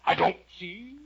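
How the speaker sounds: loud, high, imperious retort; caterpillar's curt voice. Acted. Storyteller as caterpillar answering Alice, curt and loud. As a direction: contradict her forcefully. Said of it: "said the caterpillar".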